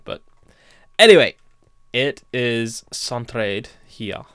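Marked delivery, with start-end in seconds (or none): none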